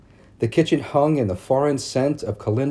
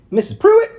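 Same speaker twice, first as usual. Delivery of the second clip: high, loud prissy society matron; prim town lady; 7 dB louder than usual. A narrator's reading. Narrator voicing Missus Cady calling on Mama, high and prim. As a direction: lift it up into a fussy church lady. Ladies voiced in read story.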